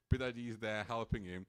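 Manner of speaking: ordinary talk, nothing marked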